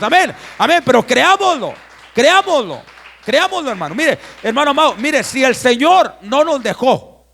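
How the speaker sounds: loud, emphatic exhortation to the congregation; full-force preaching. Real, not acted. Pastor assuring the congregation they are safe in Christ, fervent loud preaching. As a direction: preach it out loud, driving each phrase home.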